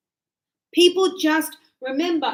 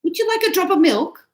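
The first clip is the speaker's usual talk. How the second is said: higher, lilting server's offer; lightly acted barista voice, pitched up; coffee shop girl; 5 semitones higher, 4 dB louder than usual. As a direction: play a chirpy cafe server asking. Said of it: "the girl says".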